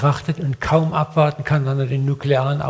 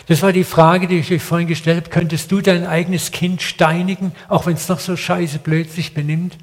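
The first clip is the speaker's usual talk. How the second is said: ordinary talk, nothing marked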